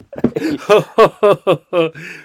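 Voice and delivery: loud, high laughing fit; burst of laughter. Real, not acted. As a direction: crack up laughing. Laughing at own fake voice.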